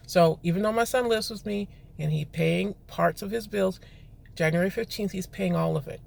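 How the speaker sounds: normal talking voice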